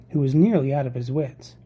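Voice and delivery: ordinary delivery, nothing marked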